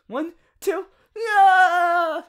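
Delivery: high, loud effortful yell; a loud, high cry on the jump; jump shout. Acted. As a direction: shout it mid-leap. Jumping to catch a bird.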